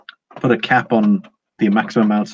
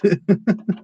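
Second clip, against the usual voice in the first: high giggle; 9 semitones higher than usual. Real, not acted. After reading the chat comment.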